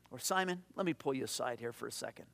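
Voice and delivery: low, soft, quick undertone; confidential aside. Acted. Jesus pulling Simon aside for a second, confidentially. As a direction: drop it to a private word on the side.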